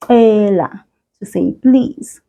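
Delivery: usual speaking voice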